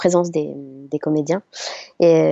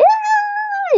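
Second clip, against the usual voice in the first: very high mock groupie squeal; imitated fan scream. Dubbing actress mimics groupies drowning out the star, high squeal. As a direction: squeal like a crowd of young fans. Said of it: "et tout le monde a fait".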